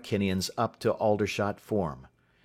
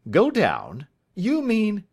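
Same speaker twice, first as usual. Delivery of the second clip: higher, puzzled master caught off guard; mild surprise, rising on go; surprised; 12 semitones higher, 6 dB louder, slower than usual. A narrator's reading. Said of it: "Mister Spence looked surprised".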